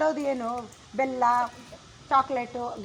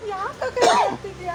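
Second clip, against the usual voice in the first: higher-pitched mock crying delivery; acted tearful plea; 4 semitones higher, 4 dB louder than usual. Shown as a demonstration. Coach showing a child how to ask an elder sister, tearfully. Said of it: "ಅಳ್ತಾ ಹೇಳು".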